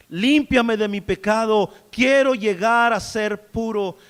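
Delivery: loud, slow, pleading prayer to the Lord; fervent preacher's prayer, loud and slow. Acted. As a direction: cry out to God for cleansing. Pleading prayer voiced to God.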